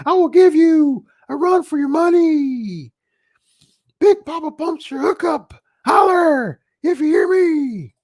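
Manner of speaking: hammed-up Steiner promo; Scott Steiner impression. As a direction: bellow it like a cocky wrestler cutting a promo. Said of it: "my Scott Steiner, uh, impersonation there".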